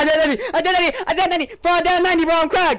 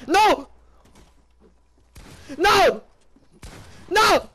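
loud frantic shouts of no; shouting no; 7 semitones higher, 26 dB louder, much slower than usual. Real, not acted. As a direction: yell it in panic at the screen. Reacting to in-game loss.

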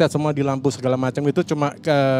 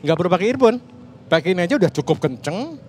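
usual speaking voice

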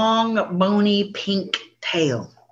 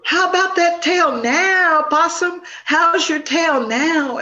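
much higher and louder, slow teasing sing-song; mocking taunt; 9 semitones higher, 3 dB louder, slower than usual. Deer taunts Possum about his ugly tail, mocking. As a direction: rub it in, savoring the joke.

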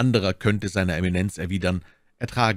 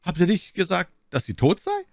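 high-pitched incredulous question; 11 semitones higher than usual. A narrator's reading. Cardinal asking D'Artagnan to confirm the death, incredulous. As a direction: check it as if unable to believe it. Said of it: "nicht gleich glauben konnte".

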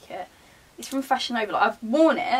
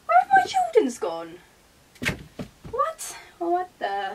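much higher, startled; alarmed surprise; 9 semitones higher than usual. Real, not acted. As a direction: panic about the missing shoes. Missing shoes, alarm.

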